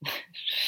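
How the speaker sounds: short, soft laugh; quiet giggle at oneself; amused break in the reading. Real, not acted. Reader amused at failed accent.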